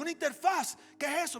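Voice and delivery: high, loud puzzled asking; baffled question. Retold or imitated. Pastor puzzled by the strange word, loud and high. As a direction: throw the question back incredulous.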